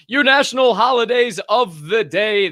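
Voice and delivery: loud, high segment announcement; hyped announcer opening the holidays; announcer intro. Real, not acted. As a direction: call out the segment like a show host.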